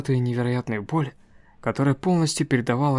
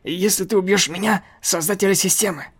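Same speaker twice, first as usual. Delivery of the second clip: high, loud panicked pleading; 6 semitones higher, 4 dB louder than usual. Acted. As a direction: blurt it out in a panic. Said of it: "Статуя в панике быстро начала объяснять".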